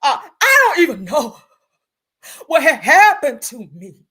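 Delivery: full fake-crying wail, loud and high; [name]. Holding chest to feel the singer's pain, overdone mock crying. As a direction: sob the words theatrically, drawn out. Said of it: "It feel a little bit more real when I do this".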